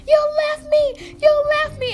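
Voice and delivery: mock mad wail. Storyteller mimics abandoned friend blaming her, mock mad wail. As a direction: whine it accusingly, imitating an angry friend. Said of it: "she was just so mad at me".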